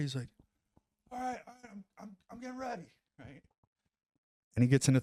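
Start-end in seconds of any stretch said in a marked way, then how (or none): 1.1-2.9 high, soft, hurried put-on friend voice; imitating buddy. Buddy getting set for his sprint, high soft quick mock voice. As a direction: squeak it out fast and quiet.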